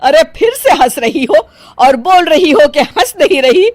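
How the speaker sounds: loud, indignant accusation; exasperated scolding. Acted. Mother-in-law catching daughter-in-law laughing again, exasperated. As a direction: call out her obvious lie.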